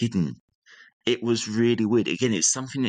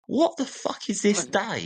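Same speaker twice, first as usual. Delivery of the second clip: high, slow incredulous outburst; high exasperated thought at the sudden snow; disbelief; 13 semitones higher than usual. Retold or imitated. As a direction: drag it out in high-pitched disbelief. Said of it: "And I thought".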